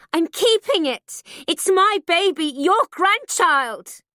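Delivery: high loud scream, drawn out at the end. A narrator's reading. Daughter screaming at her mother, betrayed. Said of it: "I scream at her".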